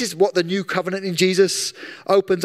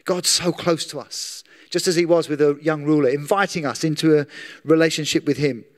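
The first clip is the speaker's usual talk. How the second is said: ordinary talk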